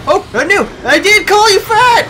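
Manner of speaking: high, loud frantic pleading with the monster; panicked yelling. Real, not acted. Player fleeing the fat monster, screaming in panic. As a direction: shout your apology as it chases you.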